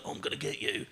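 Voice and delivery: whispered mock threat; soft, breathy taunt; menacing whisper. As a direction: hiss it like a creepy heckler. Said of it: "normal street heckles, like".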